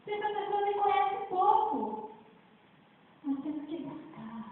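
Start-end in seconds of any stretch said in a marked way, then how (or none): 0.0-2.1 fervent preaching. Acted. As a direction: drive it home to the congregation. Preaching at full force.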